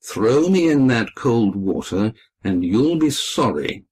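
plain reading voice

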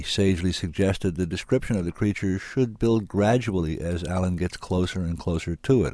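ordinary delivery

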